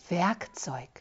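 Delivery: whispered. A narrator's reading. Bitter word repeated to herself.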